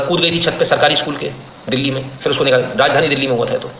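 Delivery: ordinary talk, nothing marked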